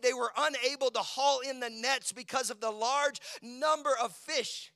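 loud, high, driving preacher voice; full-force preaching. Acted. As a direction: preach it out to the whole church.

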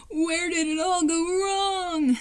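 high, long drawn-out anguished cry; hammed-up tearful lament; despairing wail. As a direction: wail it slowly in melodramatic despair. Lincoln despairs at his sisters.

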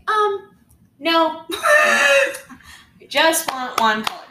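high, loud hammed-up no; mock loud refusal. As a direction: blurt the refusal in a high, loud, half-laughing voice. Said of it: "but I was really nice".

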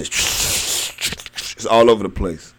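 normal talking voice

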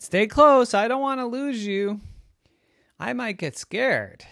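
high, loud anxious dad; a nervous dad keeping the kids close; worried daddy. Acted. Daddy to his kids, high worried plea. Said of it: "And Daddy said".

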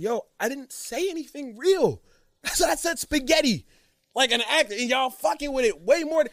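high-pitched mock exasperation; incredulous rant. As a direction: complain in high-pitched disbelief at fickle followers. Acted incredulous rant to his followers.

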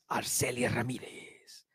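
whispered, slow trailer name drop; movie trailer voice. Acted. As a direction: breathe the name like a film promo.

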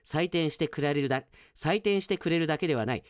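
ordinary talk

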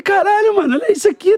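high, astonished outburst; amazed exclaim. Retold or imitated. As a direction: gasp it out in awe. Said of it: "Eu falei". (espanto animado)

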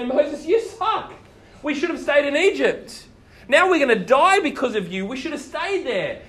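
high, whiny complaining at Moses; grumbling Israelites. Retold or imitated. Israelites at the Red Sea complaining to Moses, whiny and high. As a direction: moan like an ungrateful crowd. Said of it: "They grumble and they argue".

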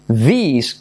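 "these" with a long EE, falling; stretched Spanish I sound in "these". Shown as a demonstration. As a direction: say "these" as "dhees". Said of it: "que suena como I".

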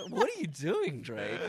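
usual speaking voice

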